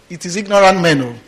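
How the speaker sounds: normal talking voice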